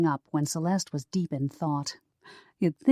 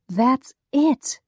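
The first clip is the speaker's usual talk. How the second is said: excited realization; 8 semitones higher, 6 dB louder than usual. A narrator's reading.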